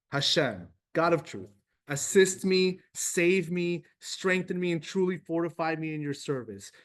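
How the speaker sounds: fervent, rising prayer read aloud. Acted. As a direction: pray it out with growing conviction. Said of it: "Let's just read it together".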